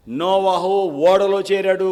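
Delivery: slow proclamation; slow preaching. Acted. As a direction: lay each word down. Slow emphatic sermon line.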